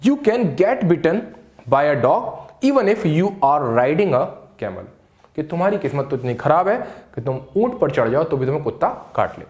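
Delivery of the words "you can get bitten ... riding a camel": slow clear dictation. Shown as a demonstration. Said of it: "तो आप वहां पर यूज़ कर लीजिएगा".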